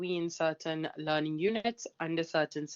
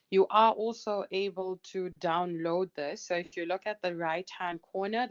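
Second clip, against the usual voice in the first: ordinary talk, nothing marked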